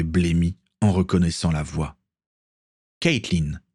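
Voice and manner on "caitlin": ordinary delivery, nothing marked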